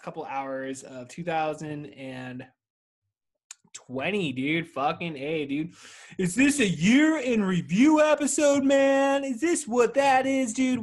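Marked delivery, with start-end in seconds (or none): 6.2-10.8 big, loud, slow announcer voice; hammed-up announcer voice; mock announcer. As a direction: boom it out like a TV host. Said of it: "mock announcer question".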